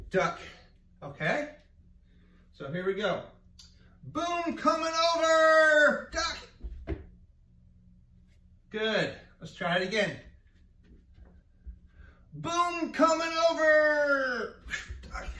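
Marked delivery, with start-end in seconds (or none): 4.1-7.0 loud drawn-out call across the deck; called command. Shown as a demonstration. Teacher calls game command. 12.3-15.3 loud slow call with a cry in it; shouted command. Shown as a demonstration. Teacher calls game command again.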